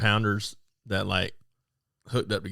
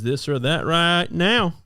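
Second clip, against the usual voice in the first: high, drawn-out show-host intro; slow, raised-pitch game-show launch; segment announcer; 8 semitones higher, 4 dB louder, slower than usual. Real, not acted. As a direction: announce the segment, stretching the words out.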